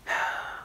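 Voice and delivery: a heavy, audible breath; deep breath. A narrator's reading. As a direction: take a deep, effortful breath. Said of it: "took a deep breath".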